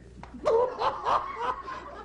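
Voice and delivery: squealing high laugh; high burst of laughter. Real, not acted. Laughter after punchline.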